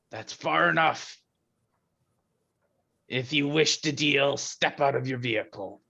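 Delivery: booming arrogant alien overlord. Acted. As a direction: call it out like distant thunder. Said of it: "with a booming voice that travels across".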